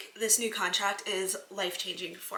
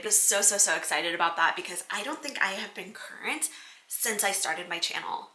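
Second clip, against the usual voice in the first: ordinary talk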